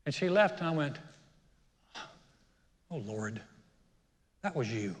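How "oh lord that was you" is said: low, hushed aside to God; quiet, lowered retold prayer; resigned prayer. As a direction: mutter a reluctant admission to the Lord.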